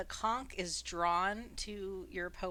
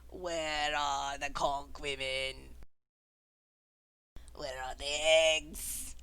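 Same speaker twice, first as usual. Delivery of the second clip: creature voice; 4 semitones lower than usual. Acted. Creature voice asking.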